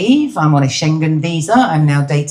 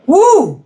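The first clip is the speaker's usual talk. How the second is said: high, loud "woo"; sharp rising-pitched party cheer; cheering whoop; 13 semitones higher, 5 dB louder than usual. Acted. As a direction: whoop like a lone audience member. Echoing a lone audience cheer.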